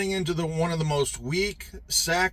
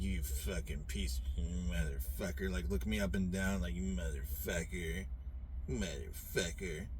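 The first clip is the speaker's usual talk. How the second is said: mock hostile muttering; 11 semitones lower, 11 dB quieter than usual. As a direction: mimic their muttered insults under the breath. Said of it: "shoot me dirty fucking looks and like".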